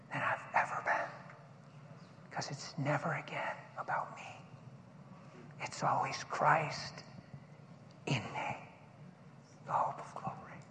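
breathy whispered sermon; whispered preaching. Acted. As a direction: whisper it with awe.

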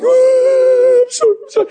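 very high wailing apology; wife's weepy, high-pitched sorry; imitated bawling. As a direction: squeal it through mock sobs. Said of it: "She's bawling".

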